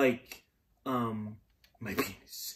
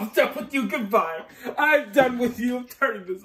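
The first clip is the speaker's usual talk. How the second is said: high, loud mock fed-up huff; mock exasperation; 8 semitones higher, 5 dB louder than usual. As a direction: pretend to storm off, hammed-up. Mock storming off from recording.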